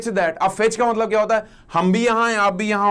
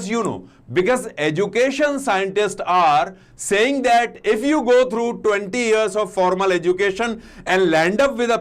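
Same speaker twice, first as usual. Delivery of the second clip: slow, word-by-word reading of the quote; slow dictation pace; much slower than usual. Shown as a demonstration. Teacher reading the quote slowly to students, word by word. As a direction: read it out slowly for students to follow. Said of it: "अब देखो वापस उन्होंने".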